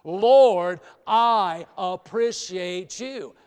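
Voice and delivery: "Lord" called out very loud, rest slow and full; heartfelt loud. Shown as a demonstration. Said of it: "say it from your heart".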